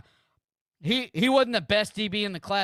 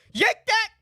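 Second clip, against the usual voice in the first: angry shout; 11 semitones higher, slower than usual. Real, not acted. Streamer yelling at the coach to grab the player, furious shout. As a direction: scream it at the TV.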